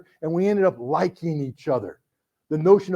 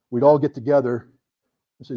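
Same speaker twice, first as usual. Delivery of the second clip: usual speaking voice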